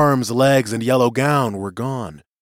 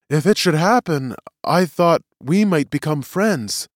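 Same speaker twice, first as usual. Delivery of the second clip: higher-pitched, earnest prisoner testifying; earnest witness; 7 semitones higher, 5 dB louder than usual. Acted. Prisoner explains himself to the tribunal, earnest. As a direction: plead the case for humans.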